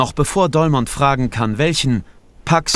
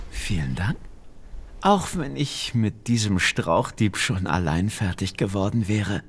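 lower, softer and kinder; soften, a little grateful; warmer witch voice; 3 semitones lower, 6 dB quieter than usual. A narrator's reading.

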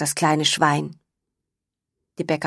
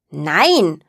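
louder, defiant Nein; shouted no; 5 dB louder than usual. A narrator's reading. Little pig shouts no at her brother. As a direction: slam the word down like a door. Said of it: "ruft das kleine Schwein".

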